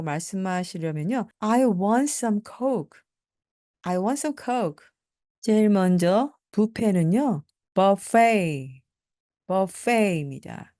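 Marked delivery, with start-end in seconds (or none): 1.4-4.9 slow, careful model sentence; deliberate classroom pronunciation; slow teaching drill. Shown as a demonstration. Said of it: "slow model sentence drill". 7.7-8.8 buffet as bofe; buffet from French; French-style buffet. Shown as a demonstration. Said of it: "발음이 조금 이상한데요".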